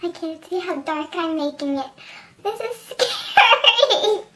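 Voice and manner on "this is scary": slow, laughing and nervous; drawn out with a nervous laugh. Real, not acted. Nervous laugh doing makeup blind.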